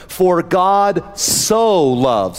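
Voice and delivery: "so" drawn out very slowly with weight; stress on so. Shown as a demonstration. Preacher dwelling on the word "so" to his congregation. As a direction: lean hard on "so", stretching it. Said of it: "that's a little word, so".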